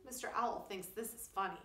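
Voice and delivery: ordinary delivery, nothing marked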